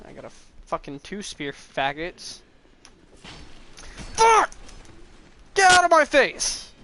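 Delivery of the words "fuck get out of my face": high, loud angry yell; furious shout. Real, not acted. Gamer yelling at an enemy in his face, furious. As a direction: scream at the enemy.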